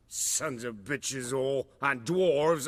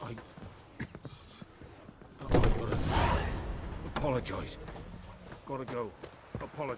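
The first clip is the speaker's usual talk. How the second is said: put-on drunken mumble; 2 semitones lower, 6 dB quieter, much faster than usual. Drunk man mumbling to himself.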